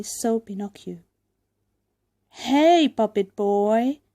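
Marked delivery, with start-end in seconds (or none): 2.3-4.0 loud, jeering call across the stage; shouted. A narrator's reading. Puppets jeering at Pinocchio, loud shout. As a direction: shout out to the new puppet boy. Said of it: "they shouted".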